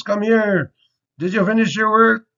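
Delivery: high mock stern teacher call. Joking teacher demanding work from a student. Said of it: "when your teacher says".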